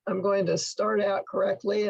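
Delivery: ordinary talk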